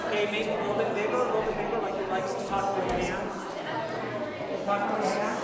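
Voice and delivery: loud, high coaching calls over the room; shouting instructions across a busy studio; calling out. Real, not acted. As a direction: project over the noisy class. Coaching moving group over noise.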